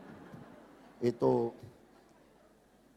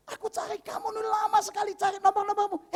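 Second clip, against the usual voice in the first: high, thinner put-on phone voice; eager caller. Old friend phoning after searching for the number, eager caller. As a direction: play an old friend calling, pitched well up.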